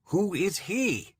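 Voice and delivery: high, slow, desperate plea. A narrator's reading. Trapped man desperately asking the unseen voice who he is. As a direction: beg for the answer, voice straining upward. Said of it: "desperation seeps into my tone".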